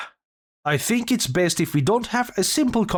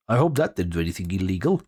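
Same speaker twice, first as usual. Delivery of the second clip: rushed muttered aside; 9 semitones lower, faster than usual. Real, not acted. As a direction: rush it out under your breath. Quick worried aside after oops.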